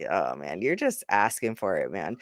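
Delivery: low, soft groaning reproach; mock dismay. Reacting to people griping about holiday weather, mock dismay. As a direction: drop the voice in mild mock dismay.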